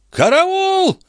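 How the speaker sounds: very high cry for help; screaming out. A narrator's reading. Stationmaster knocked into the pond, screaming out. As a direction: shriek it as high as you can. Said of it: "возопил он".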